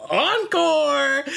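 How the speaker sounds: very high, sustained call-out; mock triumphant call. Performers announce an encore to an unwilling crowd, mock triumphant call. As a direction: sing out the word like an oblivious performer.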